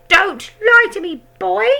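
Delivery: very high, loud hag shout. A narrator's reading. Grandma shouting at George, very high and loud. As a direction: bark at the boy. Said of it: "the enormous old hag shouted".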